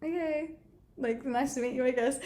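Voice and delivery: retold soft, hesitant politeness; reluctantly polite, trailing off quietly; awkward reply. Awkward reply to pushy stranger.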